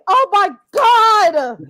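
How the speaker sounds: slow, laughing outcry; laughing shout. Real, not acted. Laughing at bad performer.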